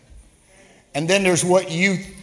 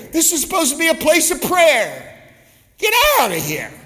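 higher and louder, a preacher's mock rage; Jesus scolding the temple traders, loud and angry; furious Jesus; 7 semitones higher, 4 dB louder than usual. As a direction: drive them out with the voice.

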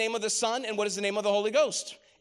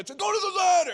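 neighbor yelling; 9 semitones higher, 3 dB louder than usual. Retold or imitated. Neighbor shouting from the yard to the stuck kid. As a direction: holler it across the lake like the neighbor. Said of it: "This is my neighbor".

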